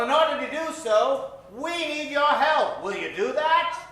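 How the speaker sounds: ordinary talk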